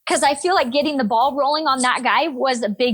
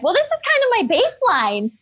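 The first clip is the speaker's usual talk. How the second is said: joking; 9 semitones higher than usual. Retold or imitated. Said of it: "I, just to be funny".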